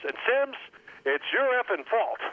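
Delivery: high-pitched accusing coach imitation; retold coach yelling. Coach turns around to blame quarterback, imitated high-pitched scolding. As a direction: turn on him with mock coach anger. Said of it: "when he yelled at you".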